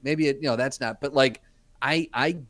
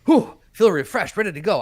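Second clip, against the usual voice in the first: mock refreshed; 7 semitones higher, 10 dB louder than usual. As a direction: ham up waking refreshed. Mocking the refreshed nap claim.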